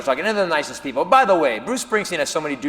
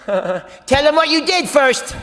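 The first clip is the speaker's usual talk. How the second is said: higher, slow teasing prompt; nagging girlfriend; 7 semitones higher, slower than usual. Retold or imitated. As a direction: egg him on like a teasing girlfriend.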